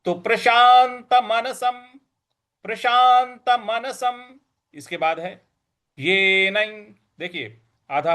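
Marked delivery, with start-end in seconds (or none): 0.0-4.4 मनसम with a clear final m, higher; anusvara said as m. Shown as a demonstration. Teacher drilling the m sound of the anusvara. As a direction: close मनसम on a full m. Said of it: "यहाँ उच्चारण इसका म होगा".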